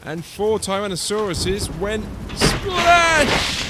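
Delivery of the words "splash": high, loud, long-drawn splash; exclaimed splash. A narrator's reading. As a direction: burst out with the sound word. Narrator acts out the splash.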